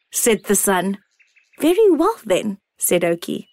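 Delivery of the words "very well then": put-on high, small childlike acorn; a tiny wondering acorn. A narrator's reading.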